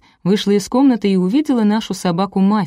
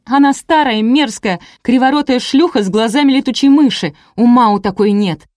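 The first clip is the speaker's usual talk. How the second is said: raised, high tirade of insults; angry yell; 5 semitones higher, 5 dB louder than usual. A narrator's reading. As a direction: spit out the insults in a jealous rage. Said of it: "заорала Леся". (гневная тирада на повышенных тонах)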